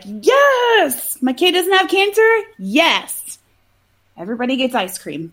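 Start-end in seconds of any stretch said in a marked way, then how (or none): 0.0-3.4 high, drawn-out celebratory cheer; acted parental joy. Normal parent hearing the kid is cancer-free, acted elation. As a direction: cheer like a hugely relieved parent. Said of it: "a normal parent would be like".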